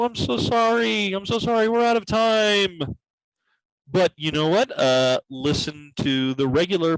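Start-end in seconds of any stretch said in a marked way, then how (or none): 0.0-2.8 hammed-up apology. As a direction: play the tragic apologizer.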